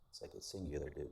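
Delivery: ordinary talk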